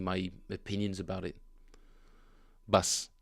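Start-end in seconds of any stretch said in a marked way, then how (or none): 2.7-3.1 Bass with a short A vowel like car, P for B; Bass said pʌs. Shown as a demonstration. Showing chat the Russian way to say bass. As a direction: Bass as pʌs, not the English bass. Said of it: "Bass with A, like in car".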